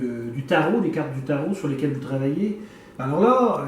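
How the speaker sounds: ordinary delivery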